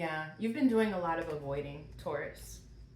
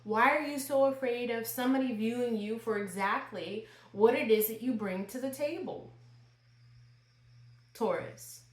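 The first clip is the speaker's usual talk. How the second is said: higher, exasperated pleading; frustrated mom; 4 semitones higher, 4 dB louder than usual. Real, not acted. Tarot reader confronting Taurus about fear of success, frustrated mom. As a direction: plead like an exasperated mother who wants answers. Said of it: "I'm like a mom getting frustrated".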